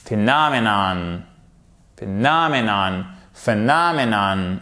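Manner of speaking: "Phenomenon" as "fe-NAH-me-nan"; stress on NOM. Shown as a demonstration. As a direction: swell on the second syllable of "Phenomenon" each time. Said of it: "regardez où se trouve votre accent".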